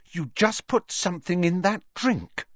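high, aghast accusation; accusing. A narrator's reading. Hermione accusing Harry, aghast.

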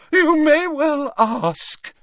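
high, slow, loud affronted voice; shrill Trelawney. Acted. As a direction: draw it out shrilly, offended. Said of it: "she said shrilly".

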